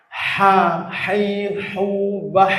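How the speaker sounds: "Ha, hai, hu, bah" with breathy friction from a narrowed mid-throat; strong throat ha. Shown as a demonstration. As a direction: press airy h sounds through "Ha, hai, hu, bah". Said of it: "Suaranya sampai seperti itu". ("Ha, hai, hu, bah" dengan ha ditekan, nafas lepas)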